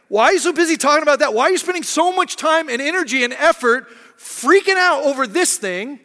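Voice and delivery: high, loud, incredulous questioning; exasperated rebuke. Acted. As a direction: pile up the questions in mock exasperation. Voices Jesus challenging people's pointless fighting.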